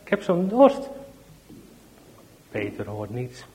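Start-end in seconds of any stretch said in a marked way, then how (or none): 0.0-0.9 put-on little child calling. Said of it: "Peter roept nog eens".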